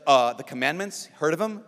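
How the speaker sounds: sassy sister, rising at the end. Retold or imitated. Sister talking back to the confessor, sassy. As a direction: toss it back as a cheeky challenge. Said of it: "She's, she's sassy".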